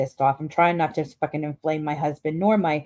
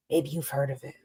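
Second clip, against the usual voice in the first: usual speaking voice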